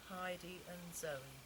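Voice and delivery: usual speaking voice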